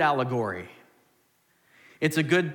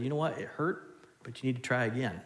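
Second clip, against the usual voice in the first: gentle comfort; 2 semitones lower, 6 dB quieter than usual. Retold or imitated. Parent comforting fallen child.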